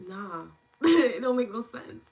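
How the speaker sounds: loud, slow dismissive laugh. Retold or imitated. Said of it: "I just was like".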